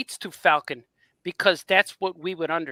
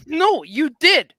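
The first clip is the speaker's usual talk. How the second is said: drawn-out, louder, higher retort; insistent contradiction; 9 semitones higher, 6 dB louder, slower than usual. Retold or imitated. Fan contradicting the author's answer, insistent. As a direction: stretch it out in playful protest.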